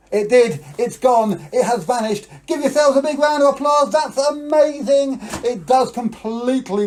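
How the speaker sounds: high, loud excited cheer; kids party magician. Acted. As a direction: whip the kids up with delight. Trick works, cheering kids on.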